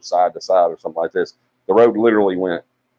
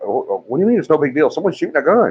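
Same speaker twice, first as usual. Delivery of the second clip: mock panic; 7 semitones higher, faster than usual. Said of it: "They're like".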